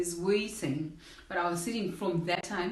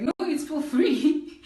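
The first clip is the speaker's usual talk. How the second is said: drawn out, higher and louder; slow insistent; 8 semitones higher, 6 dB louder, much slower than usual. Retold or imitated. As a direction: spell it out slowly to her. The lady insists, slowly.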